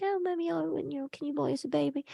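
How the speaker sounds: put-on high, soft child's voice pleading; sweet little girl. Little Annie begs mommy to buy a baby, sweet high pleading. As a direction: imitate a small daughter asking sweetly. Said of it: "little Annie's so sweet. She's like".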